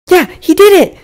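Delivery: put-on very high, loud boyish cheer; excited exclamation. A narrator's reading. Brawny cheers as they escape the cave, high excited exclamation. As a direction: whoop it out in a high little boy's voice. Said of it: "exclaimed Brawny".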